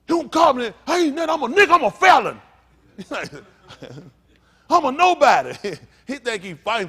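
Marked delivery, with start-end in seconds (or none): 0.1-2.4 very loud, high shouting; acted self-abasing outburst at full volume; mock indignant protest. Acts out rejecting the word ruler. 4.7-5.5 very loud, high shouting; acted self-abasing outburst at full volume; mock indignant protest.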